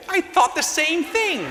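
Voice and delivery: mock high voice. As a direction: answer in a slow, squeaky put-on voice. Mock reply to neighbor Wade.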